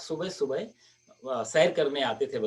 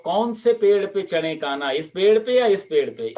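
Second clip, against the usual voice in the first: loud, careless call up into the trees; too loud; 5 semitones higher, 7 dB louder than usual. Acted. Sadhu loudly asks Kanha which tree during the theft. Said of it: "इतनी जोर से बोला".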